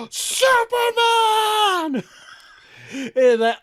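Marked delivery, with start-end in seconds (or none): 0.1-2.0 long, high, loud mock scream; kid's excited scream. Imitating his kid in the theater, drawn-out excited scream. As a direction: belt it out like the loudest child in the cinema. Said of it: "loudest one".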